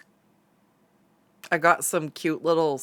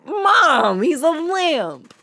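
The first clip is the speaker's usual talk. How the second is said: much higher and louder, drawn out "Mom"; exasperated whine; 12 semitones higher, 7 dB louder, much slower than usual. Retold or imitated. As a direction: plead like a mortified kid. Protesting mom's teasing.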